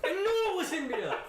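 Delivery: high, loud triumphant gloating; Columbus voice. Retold or imitated. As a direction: crow it out like a vindicated explorer. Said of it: "Christopher Columbus is like".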